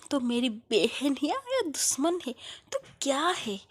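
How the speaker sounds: loud jealous tirade, building; furious rant. Acted. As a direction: shout her out of the room. Swati furious at Shreya.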